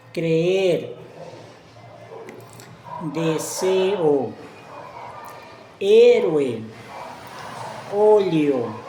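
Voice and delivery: loud, deliberate "Creer, Deseo, Héroe, Óleo"; cre-er, de-se-o, hé-ro-e, ó-le-o, every vowel sounded out; slow pronunciation. Shown as a demonstration. Teacher demonstrates two open vowels, slow separated syllables. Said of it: "Pronuncia palabras lentamente".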